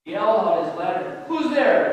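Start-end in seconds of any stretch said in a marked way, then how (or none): 1.3-1.9 louder, higher challenge into the dark; calling out. A narrator's reading. Jacob to a shadow in the alley, calling out. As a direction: call out sharply to an unseen figure. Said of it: "he called".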